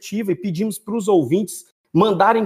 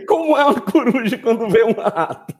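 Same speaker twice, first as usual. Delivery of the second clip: laughing; 4 semitones higher than usual. Real, not acted. Repeats the question while laughing.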